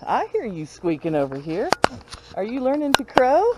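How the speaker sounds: ordinary talk, nothing marked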